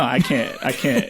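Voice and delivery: usual speaking voice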